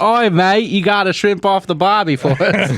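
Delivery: mocking the Australian lady, hammed-up Australian accent. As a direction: ham up an Aussie cliché. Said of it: "saying, like, the most stereotypical things".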